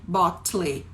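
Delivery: "bottle" read letter by letter, as "bot-lee"; hard T and sounded final E in "bottle"; spelling pronunciation of "bottle". Shown as a demonstration. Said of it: "se escreve com T T L E".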